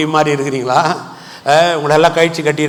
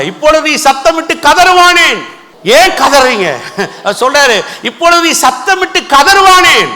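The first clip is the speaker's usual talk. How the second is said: high, ringing scriptural challenge; preacher's raised, high-pitched questioning; impassioned preaching; 11 semitones higher, 6 dB louder than usual. Acted. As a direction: cry out the verse as a challenge. Cries out the verse's question.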